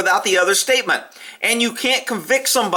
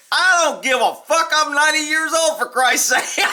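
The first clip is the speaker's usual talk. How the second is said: put-on old man quote; 7 semitones higher than usual. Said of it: "here's a statement from the ninety-year-old".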